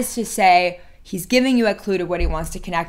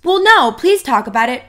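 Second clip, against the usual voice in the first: loud, high, pushy plea; insistent pleading; 6 semitones higher, 7 dB louder than usual. Acted. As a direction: nag him to open up. Acting out nagging him to talk.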